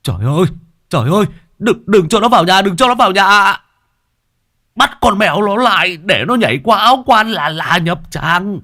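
put-on high, loud panicked old man's cry; howling in terror. Old man warning villagers about the cat, panicked howl. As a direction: beg them to stop the cat, terrified. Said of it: "Có ông già rú lên một cái".

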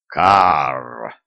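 low, drawn-out croak; raven's caw. A narrator's reading. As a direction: caw deep like a huge raven. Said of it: "увидели огромного ворона".

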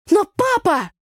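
very high, loud outraged cry; indignant protest. Acted. Daughter protesting dad's ban, loud and high. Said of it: "возмущённо воскликнула я".

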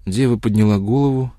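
ordinary delivery, nothing marked

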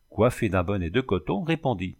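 usual speaking voice

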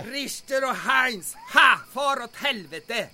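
slow, savoured jeer; slow mocking jeer at Richter and Heinz; gloating taunt. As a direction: spit the taunt at the enemy. Taunting the Germans.

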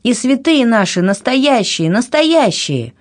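high, heated protest, last word drawn out slowly; angry insistence. A narrator's reading. Anna running downstairs defending her saints, angry. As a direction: insist furiously, pitch lifted. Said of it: "щёки её пылали гневом".

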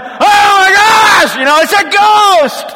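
high, loud terrified yell of scared disciples; panicked shout. Retold or imitated. Disciples on the stormy sea see Jesus, panicked high shout. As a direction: scream it like you've just seen a ghost. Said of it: "They're freaking out".

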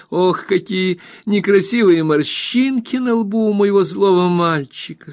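high cooing tease, slowing at the end; high teasing baby talk to her husband; teasing wife. A narrator's reading. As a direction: coo up high at a frowning husband. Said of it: "сказала Дора".

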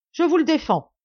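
a loud, high paternal command; forbidding shout. A narrator's reading. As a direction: forbid it outright, no discussion. Father forbids son.

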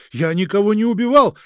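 high, loud hysterical cry; anguished shout. Acted. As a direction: cry it out in despair. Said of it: "надрывно закричал Никита". (надрывный крик)

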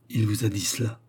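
plain reading voice

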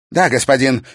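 high, loud obedient answer; obey at once; eager young witch. A narrator's reading. Witch obeys master. (покорный ответ)

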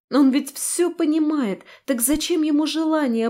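plain reading voice

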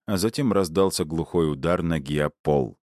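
plain reading voice

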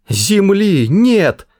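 high drawn-out wail; shouting. Acted. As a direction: cry it out in despair. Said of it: "завопил он".

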